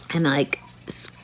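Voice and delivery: whispered. Real, not acted. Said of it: "straining while pushing".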